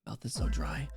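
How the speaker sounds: soft whisper to herself; quiet whispered complaint; whispered aside. Real, not acted. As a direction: murmur it under the breath. Whispered aside about dry mouth.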